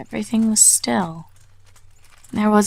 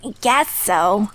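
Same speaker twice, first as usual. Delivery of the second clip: sulky; slower than usual. A narrator's reading. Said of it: "said Scootaloo sulkily".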